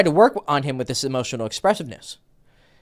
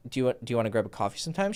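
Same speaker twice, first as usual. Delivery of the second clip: imitating a rushed, low, stumbling invitation; really nervous; 6 semitones lower, 9 dB quieter, much faster than usual. Coach mimicking client nervously asking a girl for coffee. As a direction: hurry it out softly, tripping over the start. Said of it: "he was, like, really nervous".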